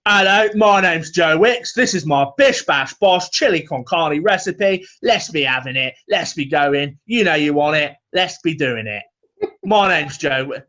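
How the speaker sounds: hammed-up fitness presenter pitching his recipe; Joe Wicks impression. Mocking the YouTube ad, a cheery Cockney pitch. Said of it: "all of a sudden".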